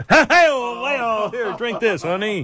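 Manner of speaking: a sly, coaxing mock Cosby; lightly voiced Cosby offering a drink; Bill Cosby impression. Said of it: "the only person who can knock out Ronda Rousey is Bill Cosby".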